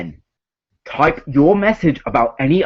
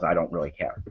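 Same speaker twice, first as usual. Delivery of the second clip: mumbled aside; 10 semitones lower, 13 dB quieter, much faster than usual. Real, not acted. Mumbled aside after plugging.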